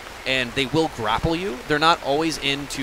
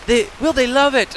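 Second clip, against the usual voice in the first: high, louder worried question; anxious wondering; 10 semitones higher, 6 dB louder than usual. Retold or imitated. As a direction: voice the team's nervous hope, pitched way up. Voicing developers' anxious hope.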